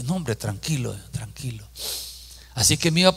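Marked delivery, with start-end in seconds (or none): 0.0-1.6 self-calming. Retold or imitated.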